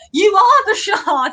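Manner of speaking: high-pitched, hammed-up declaration; mock proclamation. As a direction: imitate a tipsy friend proclaiming it. Friend's tipsy proclamation, retold.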